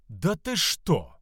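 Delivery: slow, high, icy incredulity; seething drawn-out challenge; quiet fury. Acted. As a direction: draw it out in menacing disbelief. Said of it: "наполнился отблеском тихой ярости".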